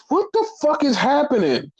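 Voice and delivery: retold baffled cry at the screen; baffled outburst. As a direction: squeak it out in total confusion. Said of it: "I was like".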